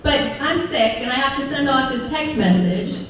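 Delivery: ordinary talk, nothing marked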